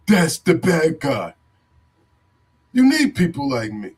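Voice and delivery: slow, drawn-out mock finger-pointer; a slow put-on voice, pointing the finger; mock accuser voice. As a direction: sneer it out slowly like the people blaming the kid. Mocking people who point fingers.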